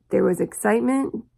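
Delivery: usual speaking voice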